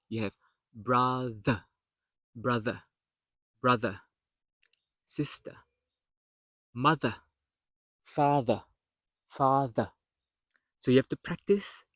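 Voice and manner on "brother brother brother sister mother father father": "brother, mother, father" singly, voiced th in the middle; TH mid-word. Shown as a demonstration. Teacher drilling middle TH, careful articulation. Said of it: "And then in the middle".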